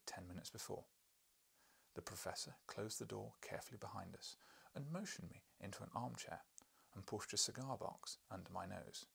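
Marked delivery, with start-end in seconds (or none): none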